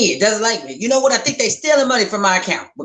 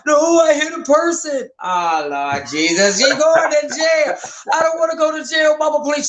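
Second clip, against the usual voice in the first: imitating frantic crying; 6 semitones higher than usual. Prank-calling daughter, mock frantic crying to mom. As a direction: play the daughter faking panic on the phone. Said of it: "believably crying frantic".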